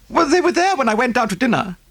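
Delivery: high, breathless socialite voice; gushing rich American lady. Acted. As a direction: pour it out like a fussy rich lady. Mrs Oppleson voiced.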